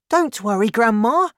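put-on high, loud young boy voice; boy reassuring grandma. A narrator's reading. Boy reassures shouting grandma in story.

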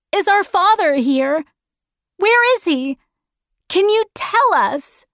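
put-on high, urgent child's questions; pleading girl. Meg asking the stranger about her father, high pleading girl. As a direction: plead for an answer in a high girl's voice.

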